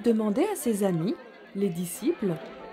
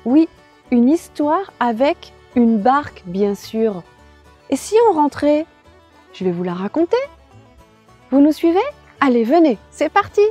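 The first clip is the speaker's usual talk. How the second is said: put-on bright children's host; 3 semitones higher, 9 dB louder than usual.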